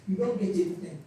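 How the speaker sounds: ordinary delivery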